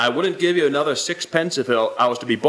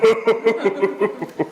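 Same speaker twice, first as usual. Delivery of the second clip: hearty laugh; 8 semitones higher than usual. Acted. Pawn customer laughs about inheritance.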